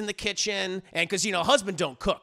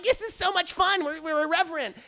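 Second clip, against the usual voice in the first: high, giddy chased woman; mock gleeful wife; 9 semitones higher than usual. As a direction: pipe up with hammed-up glee. Wife gleeful while being chased.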